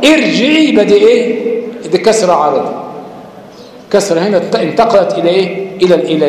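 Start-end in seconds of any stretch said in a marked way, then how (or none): 0.0-0.7 ارجعي begun with a kasra on the hamza, rising; ارجعي said as if opening the recitation; starting on the word. Shown as a demonstration. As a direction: begin ارجعي fresh with the initial i sound. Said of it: "لو بدأت تقول".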